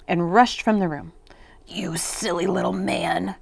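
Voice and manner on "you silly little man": softer, voiceless, rasping witch; witch's croak. A narrator's reading. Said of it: "croaked the witch".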